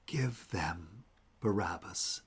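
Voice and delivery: hushed, slow whisper to himself. Pretend. Jesus whispering to himself, hushed and slow. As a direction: breathe it quietly, slowly. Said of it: "maybe he whispered it to himself".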